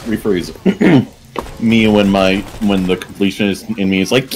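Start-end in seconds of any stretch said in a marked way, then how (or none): none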